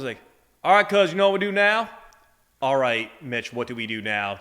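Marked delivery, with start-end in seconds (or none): 0.6-1.9 put-on thick rural Southern accent, pitched up and louder; thick Tillman Southern accent. Southern accent, Bubba rallying the crew after a bad call. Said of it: "he had this really thick accent".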